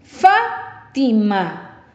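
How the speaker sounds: "Fátima" very slow by syllables, force on opening "Fá"; stress on antepenultimate syllable. Shown as a demonstration. Teacher asking where the force falls, slow stressed "Fá" in "Fátima". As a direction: hit "Fá" in "Fátima", then space out the rest. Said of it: "¿Escuchas dónde lleva la fuerza de voz?".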